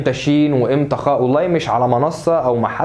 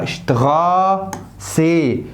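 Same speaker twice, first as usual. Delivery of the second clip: syllable by syllable; 3 semitones higher, much slower than usual. Shown as a demonstration. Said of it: "طب اللي بعديها".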